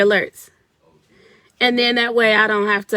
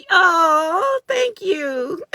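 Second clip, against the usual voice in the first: teary; 9 semitones higher, 4 dB louder, much slower than usual. Real, not acted. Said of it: "I'm getting emotional".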